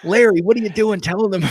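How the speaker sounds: mock movie character.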